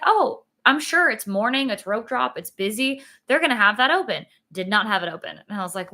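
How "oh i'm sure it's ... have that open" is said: bright confident self-quote; lightly voiced eager self; upbeat retold thought.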